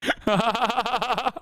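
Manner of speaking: a high burst of real laughter. Real, not acted. Streamer laughs at TikTok clip, high and real. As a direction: laugh out at the absurdity.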